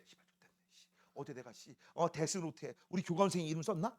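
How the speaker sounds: low, very soft grumble to oneself; muttered grumbling. Acted. Anxious student summoned by the vice principal, muttering to himself. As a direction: play a student cursing under their breath.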